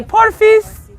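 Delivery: put-on high-pitched cutesy girl voice; cute girly. Shown as a demonstration. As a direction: squeak it out to sound cute. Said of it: "wanna be cute".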